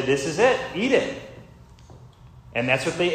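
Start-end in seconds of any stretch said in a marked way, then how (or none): 0.0-1.2 firm, putting the foot down, last word up high; firm fatherly. Retold or imitated. Moses telling the grumbling people to eat, firm and fatherly. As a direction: lay down the law like a father at dinner. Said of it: "Just put the foot down and say".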